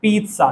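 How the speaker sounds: "पिज़्ज़ा" as "peet-saa"; correct pronunciation. Shown as a demonstration. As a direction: put a t before the s in "पिज़्ज़ा". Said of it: "सही pronunciation तो ये वाला है". ("पिज़्ज़ा" में "त्सा" की आवाज़)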